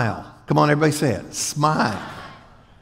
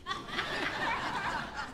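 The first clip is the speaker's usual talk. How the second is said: mock giggle; 14 dB quieter than usual. Mocking the clique.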